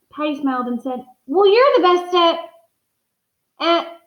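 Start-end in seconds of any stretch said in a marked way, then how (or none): 1.3-4.0 hesitant. Acted. Patty searching for his talent.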